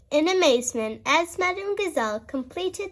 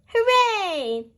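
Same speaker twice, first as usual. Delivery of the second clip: high, louder happy hooray; cheering; 6 semitones higher, 3 dB louder than usual. A narrator's reading. Children promised stickers, cheering. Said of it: "cheered the children".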